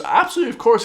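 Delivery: high, put-on manager voice; obliging manager. Manager reassuring the customer, mock obliging. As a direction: agree readily, pitched well up. Manager's eager reply, retold.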